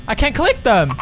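ordinary talk, nothing marked

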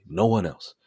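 normal talking voice

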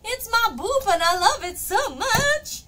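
high, loud squeal of adoration; gushing. Real, not acted. Streamer gushing over beloved Boop, gushing high and loud. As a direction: squeal it with over-the-top affection.

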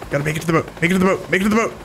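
rising panicked pleading; panicking gamer. Real, not acted. Fleeing helicopter to boat.

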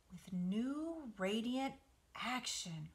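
slow, drawn-out pondering; slow thoughtful reread; slowly. Acted. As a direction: weigh each word slowly. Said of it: "repeated Charlotte slowly".

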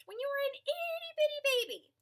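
squeaky high itty-bitty voice, a bit fast; cute high baby-talk lilt. Acted. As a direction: pitch way up like a tiny baby.

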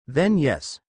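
ordinary delivery, nothing marked

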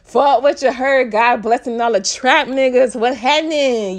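loud, hyped repeat of a winner's speech; excited shout. Retold or imitated. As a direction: shout it back like a proud fan. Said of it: "He said it".

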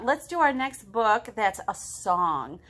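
plain reading voice

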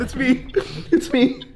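ordinary delivery

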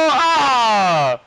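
loud, high cry of dismay; frustrated yell. Real, not acted. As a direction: yell out at the miss. Missed shot.

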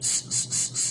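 স as a soft voiceless "sss"; whistle-like S. Shown as a demonstration. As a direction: hiss স softly with no vowel. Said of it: "শীষ দেওয়ার মতো". (স শিস দেওয়ার মতো, নিচু স্বরে)

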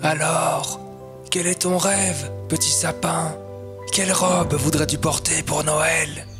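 put-on soft, kindly old-tree voice; gentle old tree. Old tree asks little fir its dream, gently. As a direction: ask tenderly, keeping it soft. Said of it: "lui demande gentiment le vieil arbre".

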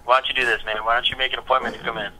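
normal talking voice

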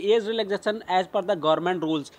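ordinary talk, nothing marked

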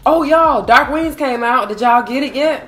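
loud excited announcement. Real, not acted.

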